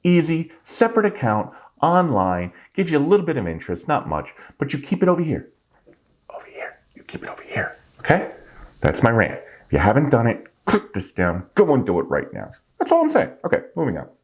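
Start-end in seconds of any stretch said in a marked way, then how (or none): 6.3-8.3 conspiratorial whisper with no voice; breathy aside to the viewer, barely audible; stage whisper. Shown as a demonstration. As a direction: lean in and whisper it like a secret. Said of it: "stage whisper after normal line".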